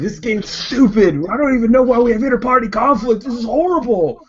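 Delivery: high, loud sulking whine; tantrum whine. Acted. Urgek throws his sheet in a high tantrum.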